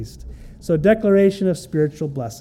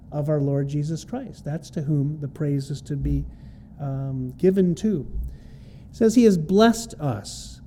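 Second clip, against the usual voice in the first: ordinary delivery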